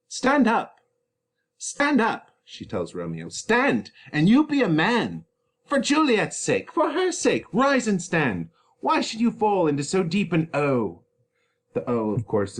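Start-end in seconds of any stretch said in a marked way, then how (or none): 0.1-11.0 high, loud nurse ordering him up; nurse scolding. Acted. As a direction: shake him out of his grief.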